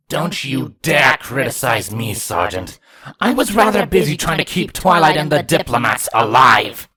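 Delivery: loud indignant outburst building up; angry rebuke. Acted. Changeling rebuking the sergeant for criticizing her, loud anger. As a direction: cut him down, furious and loud.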